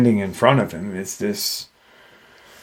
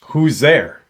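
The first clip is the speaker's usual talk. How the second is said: raised pitch, restrained call-out; mild retold yell; 4 semitones higher, 3 dB louder than usual. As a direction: imitate calling into the dark, without full volume. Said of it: "So he yells out".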